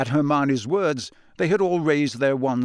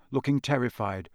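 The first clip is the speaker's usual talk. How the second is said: plain reading voice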